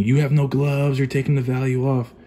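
put-on complainer voice; mock complaining. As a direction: imitate nitpicking viewers complaining. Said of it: "everyone's gonna be complaining".

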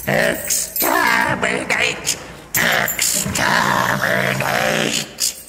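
drawn-out, high, grating shriek; slow, insane battle cry; shrieking Dalek. A narrator's reading. Dalek shrieking its battle cry at the Doctor. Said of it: "the Dalek was screaming".

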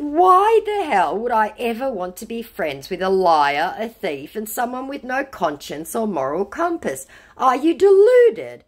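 high, mock-outraged telling off; indignant retort. Woman retelling her refusal to befriend scammer, indignant.

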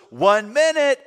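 high, loud call to the door. Retold or imitated. Brother stalls the girl outside, calling out. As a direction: holler it to someone waiting outside. Brother calls to waiting visitor.

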